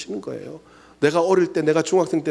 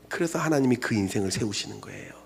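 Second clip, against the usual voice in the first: whisper; 5 semitones lower, 6 dB quieter than usual. Acted. Sermon, hushed.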